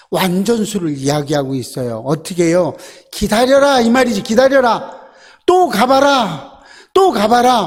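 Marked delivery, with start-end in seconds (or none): 3.1-5.0 raised, insistent order to wait; commanding call. Acted. Preacher voicing Elijah, raised command. 5.5-7.7 slow, raised urging to go again; repeated command. Acted. Preacher as Elijah urging the servant, raised.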